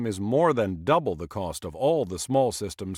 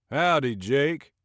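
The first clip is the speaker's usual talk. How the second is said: slow, easy Texas drawl, a bit louder; 5 semitones higher, 4 dB louder, slower than usual. Acted. Neighbor David greeting Jake in a slow Texas drawl. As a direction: stretch the greeting out Texas style. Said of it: "in his slow Texas drawl".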